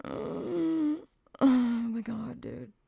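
breathy disbelieving whisper, trailing off very soft; shocked hushed aside; stunned whisper. Real, not acted. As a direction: gasp it under your breath in disbelief. Stunned after reading explicit quote.

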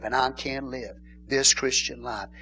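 ordinary talk

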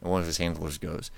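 ordinary talk, nothing marked

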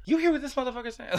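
high, fast, indignant aside; inner Kamala voice. Retold or imitated. Fan voicing what the candidate must be thinking, inner voice. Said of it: "My inner Kamala voice was like".

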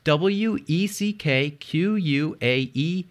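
letter by letter, unhurried; slow spelling out. Shown as a demonstration. Host spells the tribe name for listeners, slowly. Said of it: "after I spell it".

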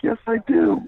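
high laughing reply; laughing panic. Retold or imitated. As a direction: blurt it out, half laughing. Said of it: "I was like".

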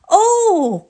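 high, bright exclamation; a boy eager to explain; eager cry. Acted. Volodya introducing his friend to mother, eager cry. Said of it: "cried Volodya".

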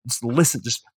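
mock frantic pleading. Man pleading with a police officer, mock frantic. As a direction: push to be believed, hammed-up urgency.

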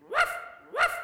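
high yappy barks; high dog bark. Acted. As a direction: yap like a small dog.

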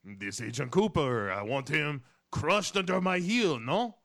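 villain's order in a hammed-up French accent; Jean Reno. Retelling Jean Reno's villain in the scene, mock French accent. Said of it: "it's once again Jean Reno saying".

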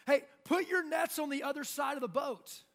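loud, high call-out; yelling from afar. Retold or imitated. Guy on the shore calling fishermen in a boat, yelling. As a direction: holler to get the group's attention. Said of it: "yelling from the shore".

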